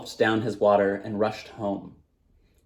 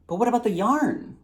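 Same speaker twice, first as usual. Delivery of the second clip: put-on high, eager young boy; 12 semitones higher than usual. A narrator's reading. Thirsty boy begs the storyteller for more, high and eager. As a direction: ask it like an excited child.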